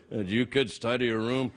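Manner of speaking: a hint of a low-voiced dad; mock TV dad. Host spoofing a show called The Walking Dad, mock dad.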